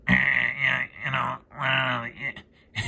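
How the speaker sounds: a hint of a worn-out, mumbling elder; drained mumble. Shown as a demonstration. Showing how the aunts and uncles talk, mumbling. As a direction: mumble it like you're too tired to finish. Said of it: "talk like this".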